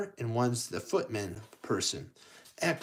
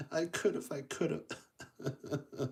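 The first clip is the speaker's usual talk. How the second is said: hammed-up weepy Cinderella; mock crying; 3 semitones higher, 8 dB quieter, slower than usual. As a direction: pretend sobbing, voice fading softer. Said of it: "And then she starts crying".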